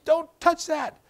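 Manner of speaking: put-on sharp high yell at the child; Don't touch that, yelled high; scream. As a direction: snap it out like a startled parent. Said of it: "and you scream".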